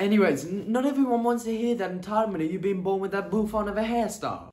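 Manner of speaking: high, dismissive New York voice; New York accent. Acted. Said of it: "speaking like you're from New York".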